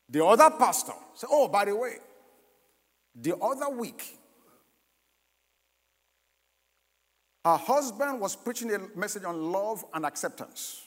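loud, ringing preacher voice; preaching. Acted. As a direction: preach it to the back row. Preaching at full force.

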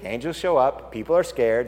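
ordinary talk, nothing marked

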